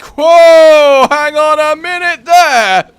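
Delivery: loud, high, drawn-out interruption; objecting shout. Acted. Know-it-all correcting Greek mythology misinformation, shouting. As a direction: holler to halt someone mid-sentence. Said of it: "and then I'm like".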